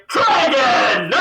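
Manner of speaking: high, drawn-out battle shout; great loud announcement. Acted. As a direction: bellow it out and hold the last sound. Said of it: "with that great loud announcement".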